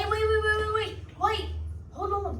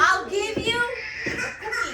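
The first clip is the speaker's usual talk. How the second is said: put-on Cockney accent; 7 semitones higher, 4 dB louder than usual. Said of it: "It was Cockney at first".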